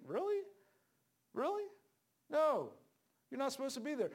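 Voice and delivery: high squeaky disbelieving "Really?"; incredulous scoff. Real, not acted. Preacher scoffs at drunk Christians, high and incredulous. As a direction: shoot way up in outrage.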